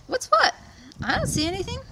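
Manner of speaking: very high, loud innocent deer; playing dumb. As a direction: pretend to see nothing. Deer feigns not seeing.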